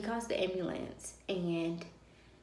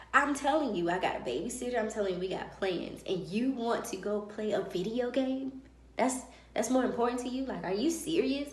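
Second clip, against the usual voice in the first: louder, heated retold complaint; scolding her husband over his video game plans; angry retort; 3 semitones higher, 4 dB louder than usual. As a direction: let him have it, fed up.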